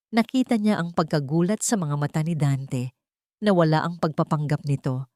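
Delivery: ordinary delivery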